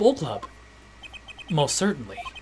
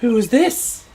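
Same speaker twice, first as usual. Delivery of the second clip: startled gasp; 8 semitones higher, 4 dB louder than usual. Acted. Gasps seeing the girl.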